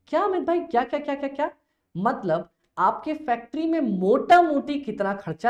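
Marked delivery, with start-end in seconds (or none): 0.1-1.5 high, startled student voice asking; teacher voicing a baffled student; puzzled student. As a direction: play the confused pupil, pitched way up. Student voice, surprised question.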